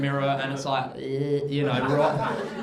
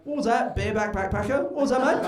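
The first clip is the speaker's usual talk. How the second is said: mock retort; 5 semitones higher, faster than usual. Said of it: "I was like".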